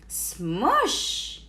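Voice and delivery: plain reading voice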